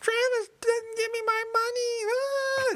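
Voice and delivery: very high, slow, hammed-up wail; mock crying. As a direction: imitate a whiny complainer blubbering to the bosses. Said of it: "like, crying".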